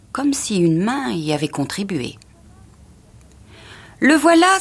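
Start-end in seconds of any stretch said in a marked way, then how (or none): 4.0-4.6 high, loud exclamation; triumphant cry. A narrator's reading. Wife spotting the lost packet, excited cry. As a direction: cry out at a sudden find. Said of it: "s'écria-t-elle".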